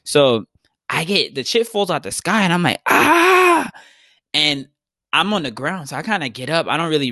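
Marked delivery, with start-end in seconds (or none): 2.9-3.7 sudden high yell of shock and pain; pained scream. Retold or imitated. Retelling being hit by a tree, a sharp scream. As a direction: cry out as the tree knocks you down.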